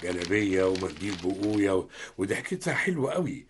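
put-on father gazing at a portrait of a pretty girl, softly; soft admiration. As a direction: murmur it, charmed by the pretty face.

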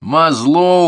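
loud, high, drawn-out jeer; mock the poor shot with relish; gloating instructor; smirking scorn. A narrator's reading. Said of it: "ухмыльнулся военрук".

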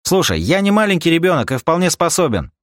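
loud, high indignant protest. Acted. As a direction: push back hotly. Rika protests.

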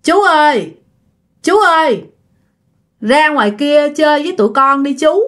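imitated boy's pleading call; ghost boy calling. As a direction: call out to coax an uncle outside. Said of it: "Nó mới kêu rằng đó là".

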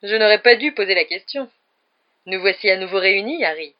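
loud, jovial exclamation; joyful. A narrator's reading. Teacher seizing Harry by the shoulders, joyful. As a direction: greet him with hearty good cheer. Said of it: "lança d'un ton joyeux".

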